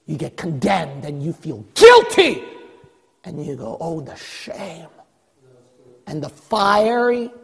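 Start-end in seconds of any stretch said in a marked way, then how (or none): 1.8-2.4 sudden loud burst on one word; shouted verdict. Real, not acted. Preacher on condemnation at judgment, sudden shout. 3.8-4.9 slow, much softer and lower; hushed shame. Acted. Believer at the judgment seat, hushed shame.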